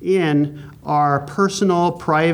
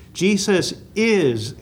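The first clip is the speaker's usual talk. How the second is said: "is" drawn out and higher; stress on is; 4 semitones higher, much slower than usual. Shown as a demonstration. Teacher stressing present tense to the class, long emphasis on "is". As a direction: lean long and high on "is". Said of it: "and that is so important".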